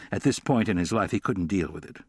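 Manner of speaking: ordinary delivery, nothing marked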